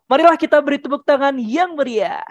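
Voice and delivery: high, rousing, quick announcement; brisk, lifted MC voice; fast MC applause call. Shown as a demonstration. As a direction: whip the audience into clapping. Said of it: "pasti dicepetin".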